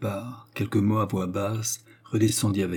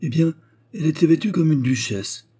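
put-on high, loud gossipy old widow; gossiping concierge; 7 semitones higher, 6 dB louder than usual. A narrator's reading. Concierge describes Eugénie to Léon, high loud gossiping voice. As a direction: pile on the scandal in a high voice.

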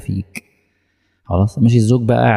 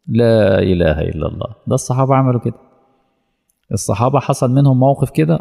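normal talking voice

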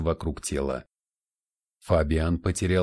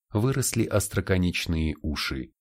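plain reading voice

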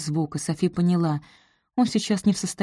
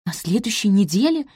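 higher, rising astonished echo; surprised question; 4 semitones higher than usual. A narrator's reading. Sophie hears of next week's wedding, surprised. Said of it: "удивилась Софи".

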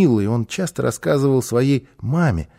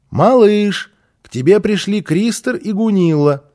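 raised, higher call to the next room; 8 semitones higher, 5 dB louder than usual. A narrator's reading. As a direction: call loudly from another room. Mother calling from the hall.

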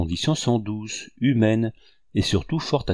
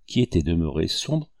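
ordinary delivery, nothing marked

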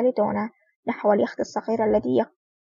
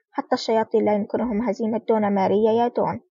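ordinary delivery

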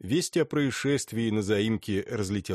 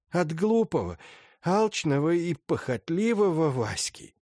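lightly voiced stern old lady; a hint of an imperious grande dame; haughty old princess; 9 semitones higher than usual.

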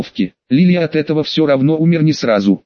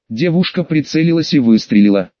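ordinary delivery, nothing marked

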